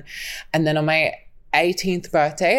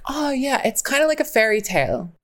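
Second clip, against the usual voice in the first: cheeky remark; 7 semitones higher than usual. Retold or imitated. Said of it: "And I was like".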